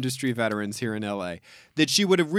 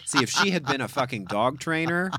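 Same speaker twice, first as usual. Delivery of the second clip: usual speaking voice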